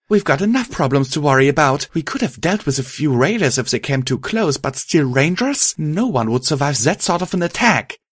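frustrated protest, voice lifted; angry outcry. A narrator's reading. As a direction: cry out in mild indignation. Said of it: "she cried out in anger".

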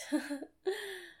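short high laugh; a quick breathy laugh. Real, not acted. Laughs after intense moment.

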